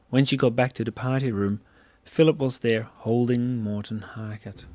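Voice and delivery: usual speaking voice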